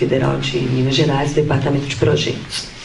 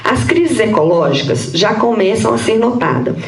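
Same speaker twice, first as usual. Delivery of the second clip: higher-pitched, measured read-aloud voice; formal paper reading; 6 semitones higher than usual. Real, not acted. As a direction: read out a prepared academic text. Reads her prepared paper aloud. (leitura formal de texto acadêmico)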